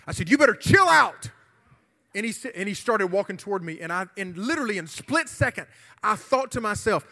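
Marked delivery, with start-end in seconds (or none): 0.3-1.3 re-enacted shout, higher and much louder; loud, high mock-tough challenge; retold confrontation. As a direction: shout it like warning off a bully. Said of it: "that's what I said, my exact words".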